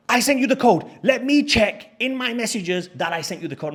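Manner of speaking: high, loud imitation of the host; mock insistence. Host insisting to guest the code was sent, indignant. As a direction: protest loudly, mimicking a stubborn host.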